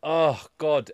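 normal talking voice

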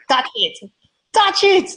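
high mock kid voice, rising; squeaky demand from a cheeky boy; pushy street kid. Hustler boy voiced in story.

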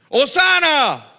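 slow, loud, high ringing cry; crowd shouting. Retold or imitated. Preacher voicing the crowd's cry of praise, loud slow shout. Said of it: "porque todo el mundo gritaba".

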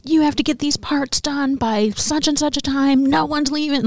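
bossy supervisor voice, barking orders; barking boss. Retold or imitated. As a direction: bark the orders like a stern boss. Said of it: "she was just barking".

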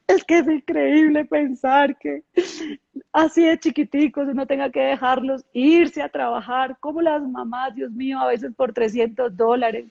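half-crying as she retells it; tearful. Retold or imitated. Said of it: "Y yo lloré, y lloré".

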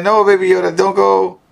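mock desperate plea.

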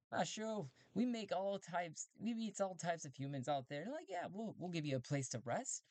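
high cheerful robot voice; friendly robot. Acted. Robots inviting Talty aboard, high friendly voice.

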